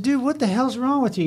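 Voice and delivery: high, exasperated scolding, half mock; exasperated retort. Captain scolding the hothead firefighter, exasperated. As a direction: let the frustration spike on the question.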